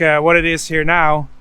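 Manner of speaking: usual speaking voice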